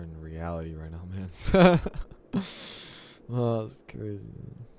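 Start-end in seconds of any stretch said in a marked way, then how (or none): 1.9-3.3 airy, high disbelieving laugh; breathless high laugh. Real, not acted. Coder laughing at the absurd error, breathless high laugh.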